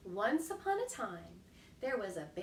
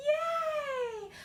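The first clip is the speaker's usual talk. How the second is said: high, loud happy yay; excited cheer; 5 dB louder than usual. Real, not acted.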